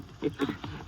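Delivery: a short breathy chuckle at himself; embarrassed laugh. Acted. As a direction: laugh off the awkward slip. Sheriff laughs at own blunder.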